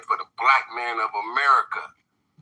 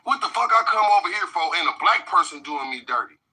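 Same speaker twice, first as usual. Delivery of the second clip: mock outrage; 6 semitones higher than usual. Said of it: "you gonna be like".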